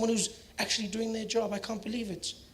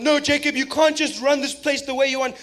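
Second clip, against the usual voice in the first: higher, louder scolding; hammed-up Helen Zille, loud and high; angry scolding; 5 semitones higher, 5 dB louder than usual. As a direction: scold Jacob like a furious MP. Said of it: "Helen and them fighting with him".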